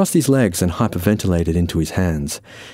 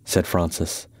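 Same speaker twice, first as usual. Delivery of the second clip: ordinary delivery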